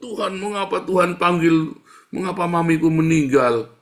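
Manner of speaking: high, loud protest to God; hammed-up demand flung at heaven; mock demanding. Preacher acts a child questioning God over mother's death, demanding.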